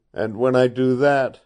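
a mild, half-voiced fierce cry; savage cry. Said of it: "cried Kent, almost savagely".

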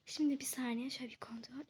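soft unvoiced whisper. Real, not acted. Checking the empty bank, she whispers to viewers. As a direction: whisper while checking for people.

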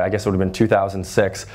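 usual speaking voice

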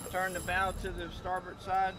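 ordinary delivery

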